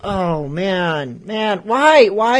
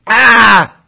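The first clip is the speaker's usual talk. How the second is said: frustrated shout; 4 semitones higher, 10 dB louder than usual. Real, not acted. Host frustrated by failed call.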